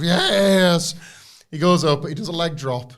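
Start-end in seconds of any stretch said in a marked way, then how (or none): 0.0-0.9 high, loud eerie command; Howdy's yes. Retold or imitated. Cult leader giving the order, a loud eerie yes. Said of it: "giving him instructions of".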